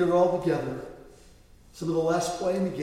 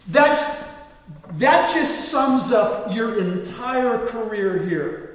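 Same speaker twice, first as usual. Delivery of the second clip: loud, slow, cutting reprimand; coach scolding; 6 semitones higher, 9 dB louder, much slower than usual. Retold or imitated. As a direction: lay it on him slowly and loud.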